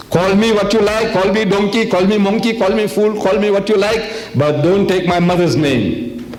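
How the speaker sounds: high-pitched rhetorical plea, building insistently; indignant acted outburst; impassioned protest. Acted protest against insulting mother.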